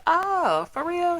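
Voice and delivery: high-pitched retold reaction; mock surprise. Reacting to gossip on the phone, mock surprised. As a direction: play it up high, as if it's news.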